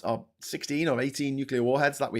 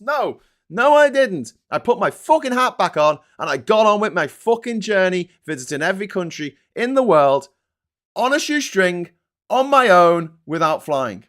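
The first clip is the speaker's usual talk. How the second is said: loud, raised-pitch defiance; defiant rant; 5 semitones higher, 9 dB louder than usual. Real, not acted. Traveller insists he carried on after jail, loud and defiant. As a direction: belt it out with proud indignation.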